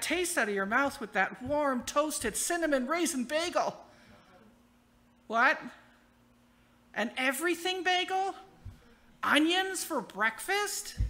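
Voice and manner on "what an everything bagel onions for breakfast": mock dismay. Dismayed at the wrong bagel.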